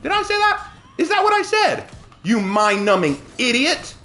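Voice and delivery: high-pitched angry outburst; real temper boiling over; getting mad. Real, not acted. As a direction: fire off indignant questions high, then the insult. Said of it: "I'm getting mad".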